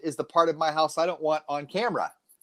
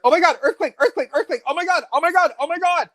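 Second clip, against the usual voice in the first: high, loud panicked squeal; mock panic, pitched way up; mimicking panicked women; 13 semitones higher, 4 dB louder than usual. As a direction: squeal it like a frantic woman on the phone. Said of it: "I just hear".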